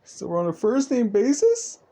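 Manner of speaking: high, loud, hammed-up choked-up question; overcome, voice climbing high; tearing up, touched. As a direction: squeak it out as if about to cry from happiness. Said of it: "he starts to tear off a bit".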